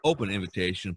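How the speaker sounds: ordinary talk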